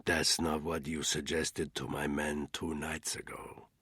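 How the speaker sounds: slow unvoiced whisper; a crime boss to his sister, whispered; quiet menace. A narrator's reading. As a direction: whisper it like a warning. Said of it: "he said quietly".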